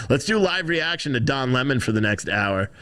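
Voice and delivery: ordinary talk, nothing marked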